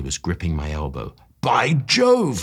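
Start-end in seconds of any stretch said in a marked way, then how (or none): 1.4-2.4 higher, alarmed exclamation; a startled cry of realisation; cried out. A narrator's reading. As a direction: friend exclaims as the drug wears off. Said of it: "he cried".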